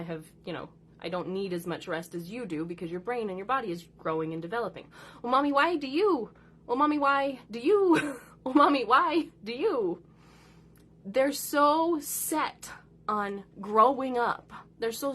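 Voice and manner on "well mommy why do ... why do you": higher, louder mock nagging child; hammed-up repetitive whining; whiny kid. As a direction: whine it like a pestering kid. Mimicking kids nagging her.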